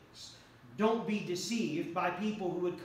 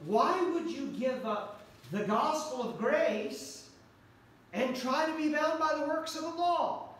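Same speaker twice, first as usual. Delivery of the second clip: raised, pleading apostle voice; voicing Paul; 7 semitones higher than usual. Paul pleading with the Galatians, raised and earnest. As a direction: plead it like Paul scolding the church. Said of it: "the Apostle Paul kept saying".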